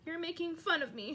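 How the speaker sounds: high, tearful whine; on the edge of tears. A narrator's reading. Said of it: "silver tears welling".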